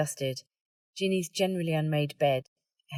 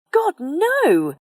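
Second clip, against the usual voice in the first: much higher, louder horrified cry; spluttering denial; 13 semitones higher, 11 dB louder than usual. Acted. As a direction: blurt it out, appalled. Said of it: "spluttering and waving her hands".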